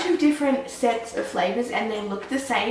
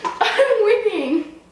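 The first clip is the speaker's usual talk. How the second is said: laughing; 9 semitones higher, much slower than usual. Real, not acted. Gloating while laughing.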